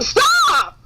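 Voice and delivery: high, sharp mock-exasperated cry; exasperated outburst. Co-host answering someone overusing her name, exasperated outburst. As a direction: cut them off with a high, half-laughing yelp. Fed-up reply to name repeater.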